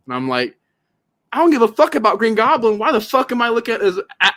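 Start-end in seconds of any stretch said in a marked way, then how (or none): 1.3-4.3 loud, high-pitched exasperated outburst; exasperated rant. Retold or imitated. Movie fan venting about an ugly villain design, exasperated. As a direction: rant in disgust, voice pushed up.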